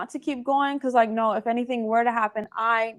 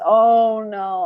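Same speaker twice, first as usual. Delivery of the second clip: mock dread; 8 dB louder than usual. Dreading a difficult client, retold.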